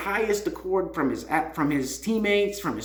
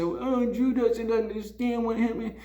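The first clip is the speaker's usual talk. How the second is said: higher mock whine of a complaining teammate; mocking whiny teammates in a high put-on whine; whiny crybaby teammate; 6 semitones higher than usual. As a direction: sneer it like a sulky crybaby. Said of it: "your whiny crybaby teammates".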